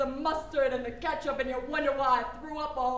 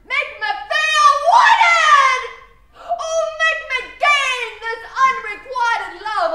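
slow, much higher howl, far louder; anguished shout; 9 semitones higher, 10 dB louder, slower than usual. Acted. Daughter howling at her father for love, slow anguished shout. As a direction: drag out a desperate cry for love.

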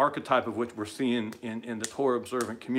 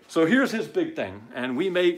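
ordinary talk, nothing marked

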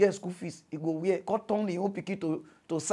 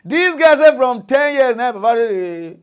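outraged exclamation; 6 semitones higher, 11 dB louder than usual. Real, not acted. Outraged retelling.

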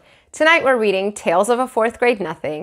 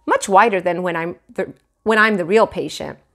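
plain reading voice

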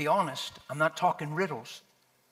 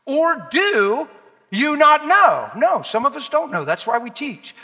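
high, loud, drawn-out scripture declamation; full-force preaching; 12 semitones higher, 11 dB louder, slower than usual. Acted. Preacher challenging the church with Romans, full-force preaching. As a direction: belt out the verse slowly to the whole room.

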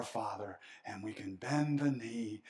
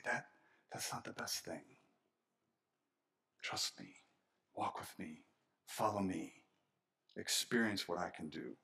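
tender voice of God; 6 semitones lower, 6 dB quieter than usual. Retold or imitated. God's voice, tender invitation.

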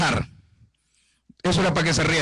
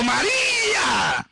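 high, long drawn-out cry of the name; wailed, exclaimed name; calling out; 9 semitones higher, much slower than usual. Acted. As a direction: call the name out high, stretching it. Calling out weeping María's name.

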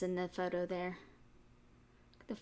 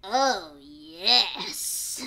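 louder, sly fox cry; gleeful fox; 2 semitones lower, 7 dB louder than usual. A narrator's reading. Fox crying out with glee at the piglet. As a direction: gloat at the visitor. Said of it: "cried the fox".

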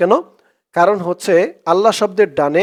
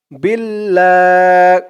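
slow, drawn-out chanted recitation; Quran recitation; 3 semitones higher, much slower than usual. Shown as a demonstration. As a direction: recite it slowly, stretching each vowel. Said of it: "recites the verse".

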